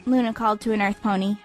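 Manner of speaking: ordinary delivery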